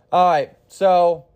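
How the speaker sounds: normal talking voice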